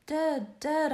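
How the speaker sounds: loud high anguished cry of dead, dead; wild cry. A narrator's reading. Man waking in darkness cries out in wild despair. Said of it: "I wildly cried".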